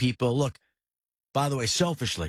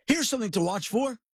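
higher-pitched, punchy announcer delivery; sponsor read; 4 semitones higher than usual. Real, not acted. Host introduces what to watch for, brought by sponsor, announcer read. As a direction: read it out as a podcast promo.

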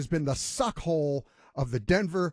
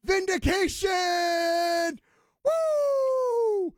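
much higher, stretched-out shout of vindication; triumphant cheer; 14 semitones higher, much slower than usual. Retold or imitated. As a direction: crow like you just won the bet. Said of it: "I was like".

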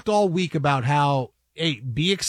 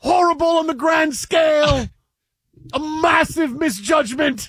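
put-on English accent; 10 semitones higher than usual. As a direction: proclaim it like an English pundit. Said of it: "in an English accent".

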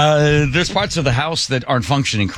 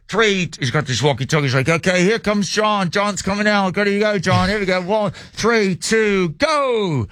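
higher, calling out the count; operator countdown; 7 semitones higher than usual. Retold or imitated. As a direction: hype him up to jump.

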